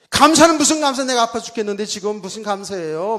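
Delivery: loud, high indignant protest, acted out; arguing back. Preacher re-enacting his argument with God while sick, indignant. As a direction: retort hotly, as in a retold quarrel. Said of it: "막 이러면서 내가 하나님 그렇게 따지니까".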